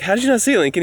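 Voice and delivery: brisk, exasperated question; quick exasperated. Retold or imitated. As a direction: snap the question out. Repeating question to son.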